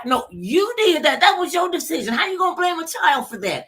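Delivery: high-pitched indignant scolding; indignant retort. Acted. Acted retort to blaming parent.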